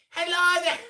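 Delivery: very high, shouted cartoon greeting; loud squeaky Irish hello; Floppy the Hammerhead. Shown as a demonstration. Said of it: "you have to shout it slightly".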